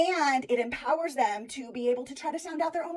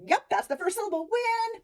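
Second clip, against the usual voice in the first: student voice; 9 semitones higher than usual. Retold or imitated. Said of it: "they're like".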